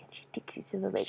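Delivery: whispered, mystical narration; hushed storytelling. Acted. Whispered narration inside the acted scene.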